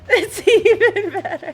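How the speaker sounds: loud laughing praise; cracking up while praising. Real, not acted.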